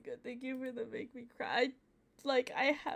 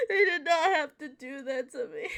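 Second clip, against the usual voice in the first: louder, tear-choked protest; real crying; 3 semitones higher, 7 dB louder than usual. Real, not acted. Streamer protesting the game made him cry, tearful. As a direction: cry it out at the screen.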